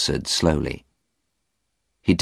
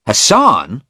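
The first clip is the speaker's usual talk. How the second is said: angry; 7 semitones higher, 6 dB louder than usual. A narrator's reading. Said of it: "Stephen said angrily".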